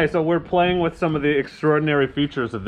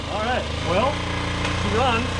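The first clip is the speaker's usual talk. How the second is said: excited; 10 semitones higher than usual. Real, not acted. Engine starts.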